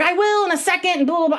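mock-breezy stalling reply; husband brushing off. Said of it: "and Richie was like".

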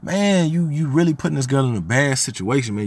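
high, indignant retold confrontation; exasperated retold scolding; going in on her. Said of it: "I'm going in on".

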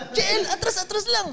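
very high, urgent mock call; uncontainable ringside coaching. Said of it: "Ako, hindi ko na mapigilan eh".

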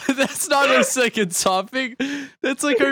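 high, slow laughing protest. Real, not acted. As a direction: laugh through the words.

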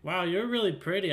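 put-on eager, wide-eyed child in character; impressed young boy. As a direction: blurt a compliment like an impressed kid. Child character answers new playmate.

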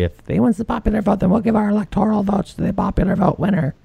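high, mocking voice of smug activists. Host mocks the vote coalition, high mocking voice. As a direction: lampoon the coalition in a high put-on voice. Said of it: "coalition of states that's gonna say".

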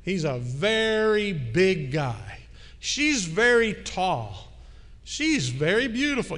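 very drawn out slowly with weight each time; slow way down and lean on every very; emphasis on very. Shown as a demonstration. Preacher showing the church how English stresses very, slow and emphatic. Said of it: "you might use a word like very".

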